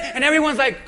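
ordinary talk, nothing marked

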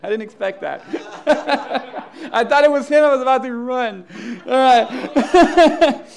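cracking up, loud and high; real laughing. Real, not acted. Preacher surprised by the rooster crow, laughing hard. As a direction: talk through a real fit of laughter.

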